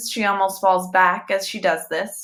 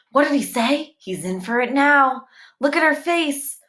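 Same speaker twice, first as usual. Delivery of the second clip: ordinary delivery, nothing marked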